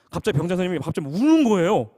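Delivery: ordinary talk, nothing marked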